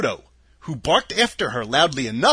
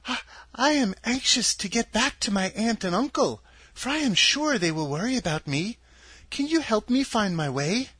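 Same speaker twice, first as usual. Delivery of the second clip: put-on higher girlish voice, anxious and pleading; a small girl asking for help to get home; lightly voiced young girl Dorothy; 7 semitones higher than usual. A narrator's reading. Dorothy begging the Munchkins to help her home, girlish higher voice. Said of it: "Then she came out again to the Munchkins and said".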